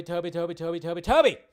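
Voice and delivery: usual speaking voice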